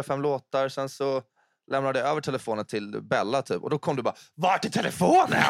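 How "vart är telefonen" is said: upprört utrop. Retold or imitated. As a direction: imitera någon som ropar i panik. Spelar upp Vickans upprörda utrop. (upprört utrop)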